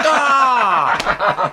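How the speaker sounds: a hammed-up cry of surprise; mock dismayed cry. Robber hearing there's no money, mock yelp. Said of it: "And she's like".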